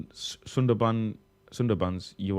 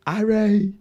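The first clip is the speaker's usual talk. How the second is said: high, loud mock Harry Potter British cry; Harry Potter British accent; 10 semitones higher, 5 dB louder than usual. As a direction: call out in exaggerated British wizard-film accent. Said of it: "replicate how they talk in Harry Potter".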